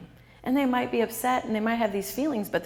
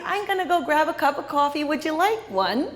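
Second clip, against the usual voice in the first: smiling, higher, louder cheerful invite; bright friendly offer; 5 semitones higher, 4 dB louder than usual. Shown as a demonstration. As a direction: offer coffee like nothing is wrong. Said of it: "just kinda say".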